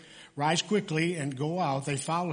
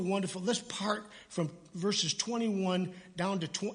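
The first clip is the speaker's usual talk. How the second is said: ordinary talk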